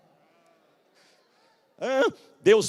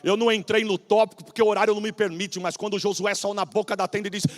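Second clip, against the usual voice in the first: ordinary delivery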